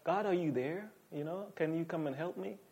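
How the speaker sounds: softly tentative plea, nothing like a cry; timid, casual prayer. Acted. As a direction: ask meekly under your breath, no urgency. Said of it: "not kind of like".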